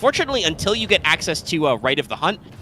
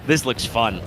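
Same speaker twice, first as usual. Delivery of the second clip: slow, dry sarcastic drawl; 2 semitones lower, slower than usual. Real, not acted. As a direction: say it meaning the opposite. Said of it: "I'm being sarcastic".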